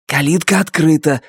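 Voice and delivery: ordinary delivery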